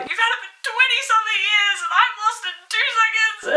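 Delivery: high, hammed-up crying protest; tearful wail. Arguing with dad over the lost t-shirt, tearful mock wail. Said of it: "I'm literally crying at this point".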